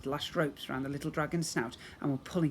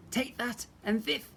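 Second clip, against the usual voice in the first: high, lisping battle cry; gleeful lisping fighter; lisping fairy sister; 8 semitones higher than usual. Acted. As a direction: tooth fairy's gleeful lisp. Tooth fairy sisters fighting with lisp.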